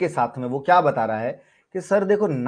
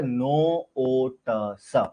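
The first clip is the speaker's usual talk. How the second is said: very slow, each sound of नो ओ ट स stretched apart; 3 semitones lower, 3 dB quieter, much slower than usual. Shown as a demonstration. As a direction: spell out नो ओ ट स sound by sound, very slowly. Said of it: "तो आपका क्या बन गया?".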